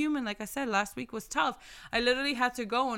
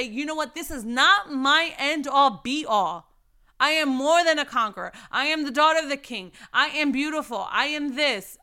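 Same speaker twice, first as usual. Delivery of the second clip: bold declaration; 5 dB louder than usual. Pretend. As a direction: proclaim it like a victory. Declaring faith aloud.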